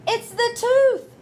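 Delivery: high, loud, slow whine; whining boy. Acted. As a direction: plead like a caught kid. Stuart pleads innocence.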